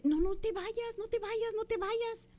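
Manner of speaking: high, urgent begging; imitated pleading neighbor. Neighbor begging her not to leave.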